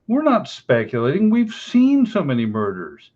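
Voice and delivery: usual speaking voice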